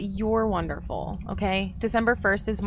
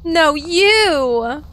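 loud, high "no, you" with a drawn-out vowel; stretched, teasing yell; playful arguing shout; 6 semitones higher, 8 dB louder than usual. Pretend. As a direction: holler it back in a mock argument. Playful argument with Cat.

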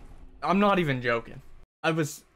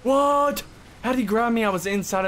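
sudden high, loud disbelieving cry; shocked yell; 9 semitones higher, 6 dB louder than usual. Real, not acted. Gamer yelling at the boss's surprise grab, shocked. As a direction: yelp out at the unfair grab. Boss grabs him unexpectedly.

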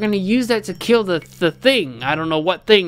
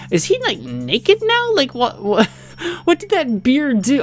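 shocked disbelief; 6 semitones higher than usual. Real, not acted. Shocked at naked gnome.

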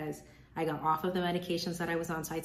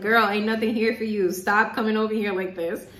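lightly voiced sassy girlfriend, louder; sassy email; 4 semitones higher, 8 dB louder than usual. Her email inbox scolding her for checking, mock sassy and loud. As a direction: give a louder, sassy brush-off. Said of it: "my email was probably like".

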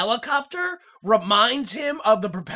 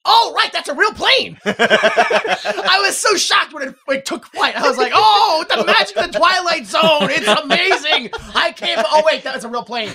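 higher and louder, laughing in disbelief; mock amazed excitement; 8 semitones higher, 8 dB louder than usual. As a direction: gush like you just saw real magic.

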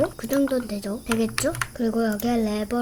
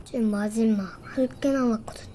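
whisper; 3 semitones lower, 6 dB quieter than usual. Real, not acted. Quiet aside to viewers.

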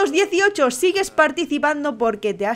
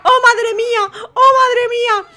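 hammed-up wailing contestant; 8 semitones higher than usual. Commentator re-voices the contestant losing her friend, hammed-up wail. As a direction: overplay the despair.

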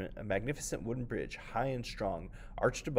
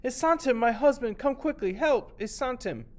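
shouting for help; 7 dB louder than usual. Acted. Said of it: "she shouted".

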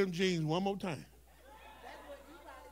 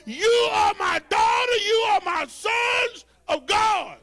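loud, raised preacher's shout with stretched key words; full-force preaching; 9 semitones higher, 9 dB louder than usual. Acted. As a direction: preach it out to the whole church, loud and high.

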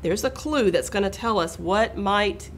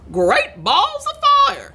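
very high, loud astonished goose; excited goose cry; 6 dB louder than usual. A narrator's reading. Goose spots the nest, loud high cry. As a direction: whoop it out as an amazed goose. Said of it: "she cried".

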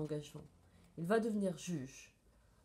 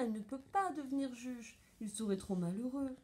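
plain reading voice

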